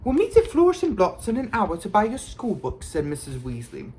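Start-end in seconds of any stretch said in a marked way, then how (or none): 0.0-2.9 high-pitched motherly voice; brisk motherly instructions. A narrator's reading. Missus Weasley instructs her family.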